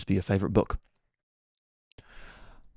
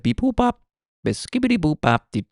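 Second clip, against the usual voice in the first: high-pitched scat-like phone beeps; imitated beeping; 8 semitones higher than usual. Caller imitates payphone beeping, high and silly. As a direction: mimic electronic beeps in a squeaky high voice. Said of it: "I hear a little bit of beeping".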